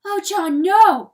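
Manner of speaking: high, loud protest; horrified cry. A narrator's reading. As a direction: cry out to stop him. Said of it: "cried Pertolote".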